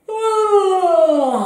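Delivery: big loud yawn falling from high; sonorous yawn. Shown as a demonstration. Coach urges pupils to let go, sonorous yawn. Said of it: "Alors sonore".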